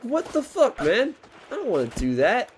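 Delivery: outrage at a suit costing too much; outraged disbelief. Retold or imitated. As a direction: pitch up in outrage at the price. Retells reaction to suit price.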